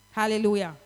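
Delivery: ordinary talk, nothing marked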